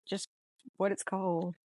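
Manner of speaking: ordinary talk, nothing marked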